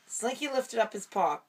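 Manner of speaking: usual speaking voice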